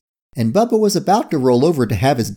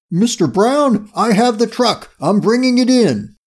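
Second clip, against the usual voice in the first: raised, higher-pitched crook's voice; crook on the phone; 9 semitones higher than usual. A narrator's reading. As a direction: report urgently to the boss.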